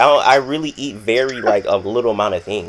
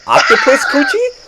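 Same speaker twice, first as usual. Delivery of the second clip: much higher and louder, laughing in disbelief; shocked disbelief; 9 dB louder than usual. Retold or imitated. Recalling his reaction to the dinner, shocked laughing squeal. Retold shock at strange food.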